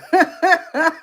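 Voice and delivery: loud, high burst of laughter; real cackling laugh, high and loud; big laugh. Real, not acted. Streamer amused by own bedtime habits, laughing hard.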